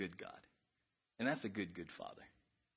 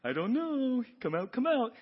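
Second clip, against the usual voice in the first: slow, higher hide-and-seek call; playful seeking God; 10 semitones higher, 8 dB louder, slower than usual. Acted. God calling to Adam hiding behind the tree, slow and playful.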